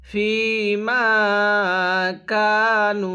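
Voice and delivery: টেনে পড়া; elongated vowels. Shown as a demonstration. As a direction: stretch the vowel of ফিমা and কানু. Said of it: "মিমের মধ্যেও টান দিব".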